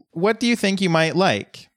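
high, sing-song condescending ask; a hint of a smug mentor; mock patronizing question. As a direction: talk down to him sweetly. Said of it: "they're like".

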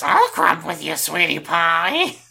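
lightly voiced Marge, slow; Marge impression. Host voicing Ursula as Marge Simpson. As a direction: drawl out sweetie pie like Marge. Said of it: "It's an okay Marge".

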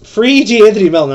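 loud, high pleading shout; fired-up plea for a player; worked-up plea. Real, not acted. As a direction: beg at the top of your voice. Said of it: "I'm getting worked up here".

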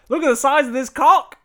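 put-on loud, high Australian accent. Retelling a boyfriend's line from TikTok, loud mock Australian accent. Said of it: "Is that your Western Australian accent?".

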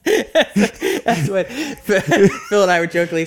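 high, loud, laughing through words; real laughter breaking into the speech. Real, not acted. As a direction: still cracking up while starting to explain. Talking while still laughing at joke.

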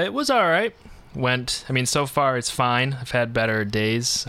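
ordinary talk

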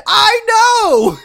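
very high, loud scream; full-voiced acted shriek; screamed outburst. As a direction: scream it in exasperated frustration. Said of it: "She should have just fucking screamed".

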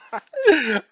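high-pitched helpless laugh. Real, not acted. Man recalling almost burying his helper, high helpless laughter. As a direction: crack up at your own story.

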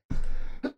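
muffled, stifled sneeze; sneezing. Real, not acted. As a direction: hold the sneeze back into your hand. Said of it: "Excuse me, I'm gonna sneeze".

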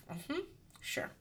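sarcastic. Retold or imitated. Sarcastic inner reply.

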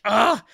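loud, high frustrated "ah"; retold groan of frustration, thrown out loud; exasperated cry. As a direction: let out a burst of exasperation. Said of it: "it just drives me nuts".

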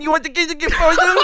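put-on high childish parroting, laughter creeping in; fifth-grade mocking. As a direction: throw it back like a taunting schoolkid. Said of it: "like, fifth grade on this dude".

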